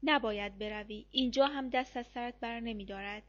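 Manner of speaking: ordinary delivery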